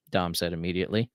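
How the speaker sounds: ordinary delivery, nothing marked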